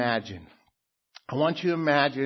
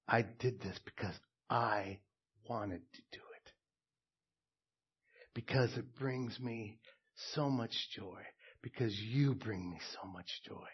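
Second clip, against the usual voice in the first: much softer, gentle and loving; joyful, tender; 4 semitones lower, 8 dB quieter than usual. Shown as a demonstration. The glad husband answering his wife, soft and tender. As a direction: speak it softly from the heart. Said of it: "The other answer says".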